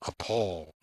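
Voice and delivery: ordinary delivery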